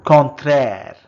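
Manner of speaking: first take, projected clearly for the class; loud model word. Shown as a demonstration. Teacher modelling a French word for learners, loud and clear. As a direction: call out the French word loudly. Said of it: "Contrary becomes".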